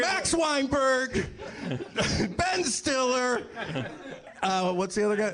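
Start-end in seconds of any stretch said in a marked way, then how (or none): 0.0-1.2 high, drawn-out name call; mock roll call. Comic airing grudges to a live audience, mock roll call. 2.4-3.4 high, drawn-out name call; mock roll call. Comic airing grudges to a live audience, mock roll call.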